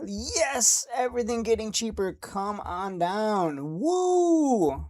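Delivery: high-pitched yes sliding into a drawn-out come on down and a long high woo; gleeful cheer. Real, not acted. Streamer cheering on a falling market, gleeful. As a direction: celebrate with a stretched, whooping cheer.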